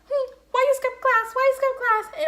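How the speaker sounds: high, loud mock grandma scolding. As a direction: squawk it like an outraged grandma. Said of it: "My grandma was like".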